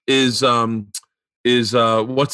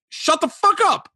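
mock stunned disbelief; slower than usual. Hearing Letterman praise him, mock disbelief. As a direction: shoot way up high in mock disbelief.

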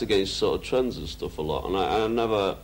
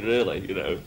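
ordinary talk, nothing marked

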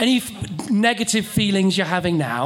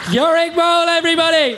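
announcer shout; 9 semitones higher, 6 dB louder than usual. Real, not acted. Host calls the act offstage.

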